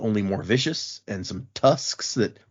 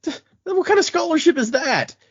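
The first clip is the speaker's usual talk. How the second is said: indignant disbelief. Retold or imitated. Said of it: "she's like".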